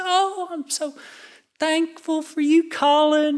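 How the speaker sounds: put-on mom's Southern accent, pitched very high; high-pitched, slow Southern mom impression; adorable Southern accent. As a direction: imitate a sweet Southern mother, high and drawn out. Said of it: "just an adorable Southern accent".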